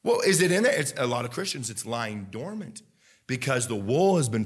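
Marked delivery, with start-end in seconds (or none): 0.0-0.8 higher, sung-through gospel preaching over music; full-force preaching. Acted. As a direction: lift the church, half singing the lines.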